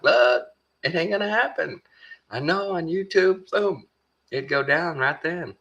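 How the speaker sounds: hammed-up imitation of a guy laying it out; streamer explaining. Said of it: "He's like".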